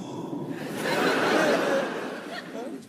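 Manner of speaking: airy, unvoiced giggle; high breathy laugh. Real, not acted. Comedian laughing at his own story, airy high giggle. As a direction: let a silent laugh out.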